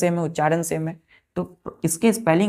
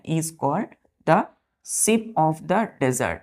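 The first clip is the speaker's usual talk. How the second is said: stretched slow, with stress on sheep; slow dictation; much slower than usual. Shown as a demonstration. Teacher repeating the sentence slowly. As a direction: give the phrase slowly so it can be copied. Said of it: "यानी ऊँट को क्या बोला जाता है?".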